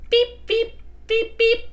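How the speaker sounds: high-pitched short beeps; imitated beep. [name] pretends to honk the steering column, high beeps. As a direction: imitate a horn in a high little voice.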